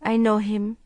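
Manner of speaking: lightly voiced man, without expression; a hint of flat, detached reply; toneless. A narrator's reading. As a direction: say it emotionless. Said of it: "he said tonelessly".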